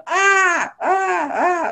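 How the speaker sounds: mock little-child sobbing wail, sharp then trailing; upset child wailing. The upset inner child crying out to its critic, wailing. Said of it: "And the child going".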